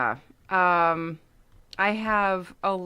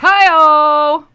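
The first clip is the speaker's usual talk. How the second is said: playful whoop; 8 semitones higher, 15 dB louder than usual. Pretend. Playful exclamation after beast joke.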